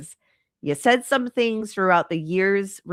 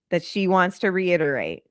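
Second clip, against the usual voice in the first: ordinary talk, nothing marked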